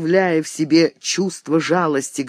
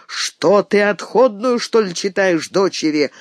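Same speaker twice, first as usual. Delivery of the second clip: ordinary delivery, nothing marked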